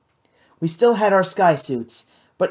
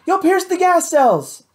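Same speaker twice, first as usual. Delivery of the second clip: high, loud warning; yelling; 13 semitones higher, 4 dB louder than usual. A narrator's reading. Matt warns Hal about the gas cells, high yelled warning. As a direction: yell the danger at him. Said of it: "I yelled".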